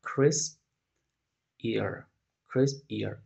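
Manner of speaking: "Crisp" then "ear", split into two syllables. Shown as a demonstration. Teacher splitting a word into syllables for students. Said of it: "Fíjate entonces cómo dividiríamos".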